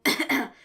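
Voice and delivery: short, loud cough; loud throat-clear. A narrator's reading. Kenny readying a put-on voice, loud throat-clear. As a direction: clear the throat noisily to announce a voice. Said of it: "Kenny coughed into his hands".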